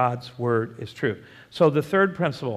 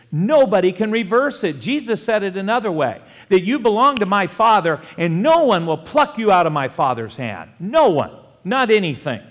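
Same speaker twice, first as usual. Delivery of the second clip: raised voice, punching the negatives; emphatic preaching; 5 semitones higher, 6 dB louder than usual. Acted. Preacher declaring nobody can pluck believers away, emphatic. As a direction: assure the congregation with full conviction. Preaches eternal security with force.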